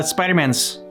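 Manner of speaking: ordinary delivery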